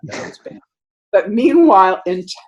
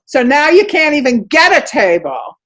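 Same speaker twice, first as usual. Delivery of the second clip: furious yell, much higher and louder; screaming across restaurant; 7 semitones higher, 7 dB louder than usual. Retold or imitated. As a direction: bellow it across a packed dining room.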